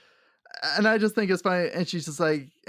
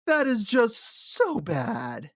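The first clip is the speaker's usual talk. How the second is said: slow disgust; 6 semitones higher, much slower than usual. Retold or imitated. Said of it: "I'm just like".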